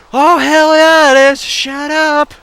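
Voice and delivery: very high, loud mock rebuke; Jesus shouting back. Jesus shouting down the excuse, loud high mock rebuke. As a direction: bellow the retort comically. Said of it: "I'd be like".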